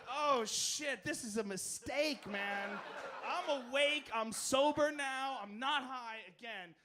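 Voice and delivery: loud, high, panicked realization. Retold or imitated. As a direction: freak out, half laughing. Panicked inner voice at strip club.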